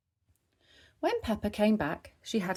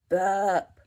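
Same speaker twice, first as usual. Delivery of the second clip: put-on baby burp sound; 7 dB louder than usual. A narrator's reading. Baby George burped.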